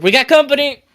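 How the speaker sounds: high, loud shout to the group; yelled warning. Acted. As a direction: call it out to alert your crew. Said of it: "I'm gonna yell back to the group".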